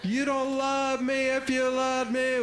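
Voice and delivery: high, slow, whiny guilt trip; guilt-tripping kid. Retold or imitated. Kid guilt-tripping a parent, high slow whine. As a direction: draw it out like a wounded child. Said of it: "What does level two sound like".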